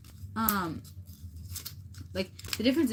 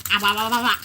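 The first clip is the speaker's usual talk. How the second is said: loud babble; 3 semitones higher, 12 dB louder than usual. Real, not acted. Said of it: "babbling nonsense".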